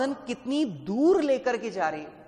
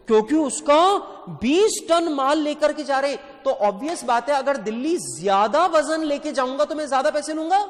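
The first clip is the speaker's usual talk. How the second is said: eager student answer; 5 semitones higher, 5 dB louder than usual. Pretend.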